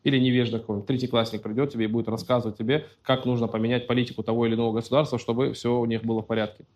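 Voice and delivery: ordinary talk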